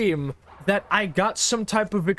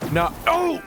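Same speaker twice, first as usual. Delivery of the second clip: startled shout; 12 semitones higher than usual. Real, not acted. Struck by lightning in game.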